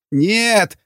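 high-pitched terrified refusal; frightened cry. A narrator's reading. Prisoner sees the hunting knife, frightened cry.

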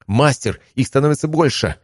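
urgent call over battle noise; shouting. A narrator's reading. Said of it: "крикнула она".

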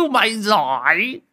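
high, hearty laughing cheer; father crowing with laughter at his son; laughing shout. Acted. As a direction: crow with delight, slowly. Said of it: "rồi hô lên".

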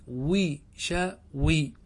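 وشوي very slowly, split wi-sha-wi; syllable by syllable. Shown as a demonstration. Teacher showing students the opened middle letter, وشوي slowly syllabified. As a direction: break وشوي into three separated syllables.

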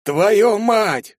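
high, loud angry curse; ругань. A narrator's reading. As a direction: swear out loud in frustration. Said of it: "выругался Карасик". (громко выругаться)

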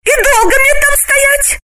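very high, indignant woman's voice; high, irritated female voice; angry question. Acted. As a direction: snap back, high and cross. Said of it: "сердито осведомилась Галина".

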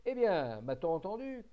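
loud, high furious roar; booming captain's yell; thundering shout. Acted. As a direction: thunder it at the idle crew. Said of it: "cria le patron d'une voix tonnante".

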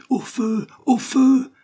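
high, loud frantic scream. A narrator's reading. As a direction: howl it in panic. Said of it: "hurlait Wilson".

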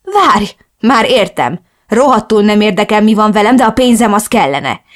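keserű vád; bitter accusation. Acted. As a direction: throw the accusation in her face. Daughter accusing mother.

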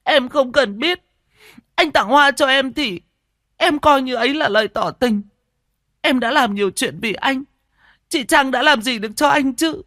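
high, petulant tantrum; Siêu, furious. A narrator's reading. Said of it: "Cô Siêu tức tối, dẫm chân bình bịch". (tức tối, giận dỗi the thé)

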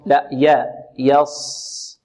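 thin, open يا before the heavy sad; light ya. Shown as a demonstration. Teacher correcting the students, light ya. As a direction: keep the ya of يص light. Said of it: "الياء هنا مرققة".

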